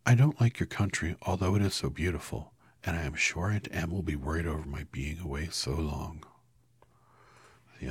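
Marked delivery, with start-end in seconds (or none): 0.1-6.2 put-on girl telling the wizard she misses home; earnest Dorothy. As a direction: beg like a homesick child.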